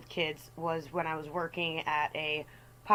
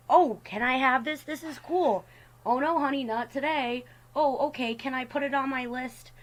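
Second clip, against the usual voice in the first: put-on higher, sweet little kid voice; polite nephew; 6 semitones higher than usual. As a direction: lightly voice a polite four-year-old. Nephew and auntie dialogue acted.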